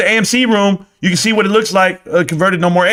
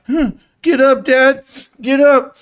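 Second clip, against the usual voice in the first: put-on high, slow child's plea; little Simba imitation; 7 semitones higher, slower than usual. Son playing Simba to his sleeping dad, high pleading. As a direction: plead like a cub trying to wake his father. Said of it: "I was little Simba in the gorge".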